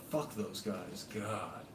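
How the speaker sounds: ordinary talk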